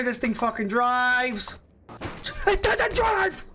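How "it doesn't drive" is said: high frustrated yell; frustrated shout. Real, not acted. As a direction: yell it at the broken car. Car fails, shouts.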